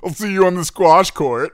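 put-on high, loud country-club lockjaw accent. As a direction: clench the jaw like a preppy club member. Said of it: "you genuinely morph into this different human".

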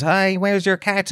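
a hint of a child's slow question; little boy. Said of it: "he often goes".

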